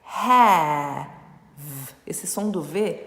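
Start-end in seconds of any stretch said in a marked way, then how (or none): 0.0-1.1 "Have" drawn out loud in one syllable. Shown as a demonstration. Teacher modelling have for students, loud and drawn out. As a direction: stretch the a, no vowel after v. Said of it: "A de é".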